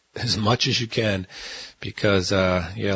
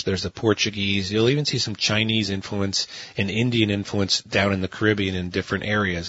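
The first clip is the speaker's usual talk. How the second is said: normal talking voice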